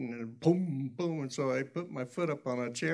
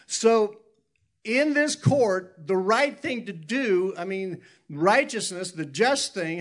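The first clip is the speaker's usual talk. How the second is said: preaching louder; 6 semitones higher, 6 dB louder, slower than usual. Real, not acted. Preacher building to a point.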